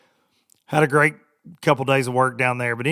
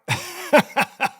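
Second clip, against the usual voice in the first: real laugh; 10 semitones higher than usual. Real, not acted. Laughing at own joke.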